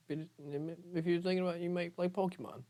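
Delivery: very high, held-up pitch; playful coaxing. Pretend. Nudging wavering viewers toward a Pokemon channel, playful coaxing. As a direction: coax them in a high, level singsong.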